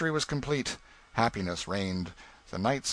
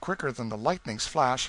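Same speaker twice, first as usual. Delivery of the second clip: ordinary delivery, nothing marked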